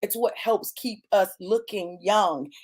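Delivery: ordinary talk, nothing marked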